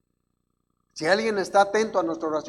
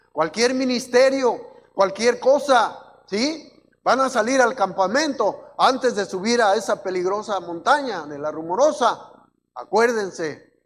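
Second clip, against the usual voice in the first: loud, raised preacher's delivery with stretched key words; full-force preaching; 5 semitones higher, 6 dB louder than usual. Real, not acted. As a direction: exhort the congregation, voice lifted and loud.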